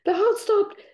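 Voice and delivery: high, loud panicked cry of a surgeon; a surgeon freaking out as the heart stops. Retold or imitated. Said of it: "like, freaking out".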